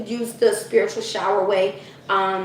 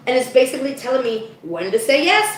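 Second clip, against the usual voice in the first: ordinary talk, nothing marked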